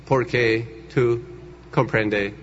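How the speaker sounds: low, slow gringo translator struggling in Spanish; halting mock American accent, pitched low; bad American-accented Spanish. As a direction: imitate an American fumbling for Spanish words. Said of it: "el traductor ni siquiera hablaba bien español".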